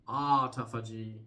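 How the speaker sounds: autophagy drawn open as AH-tah-fah-jee; trial pronunciation. Shown as a demonstration. Presenter offers a third guess at the word, autophagy as AH-tah-fah-jee. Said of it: "understanding how to even pronounce this word".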